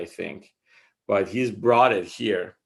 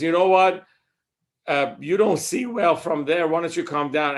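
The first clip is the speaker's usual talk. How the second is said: higher, louder exasperated retort; retold pissed-off husband; 7 semitones higher, 4 dB louder than usual. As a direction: play the fed-up husband, pitched up. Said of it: "gets really pissed off and says".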